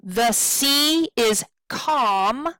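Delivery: slow, with long "sea" and "calm"; weight "sea" and "calm", keep "The" and "is" light; alternating stress. Shown as a demonstration. Teacher sounding out stresses, slow weighted "sea" and "calm". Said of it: "is an unstressed".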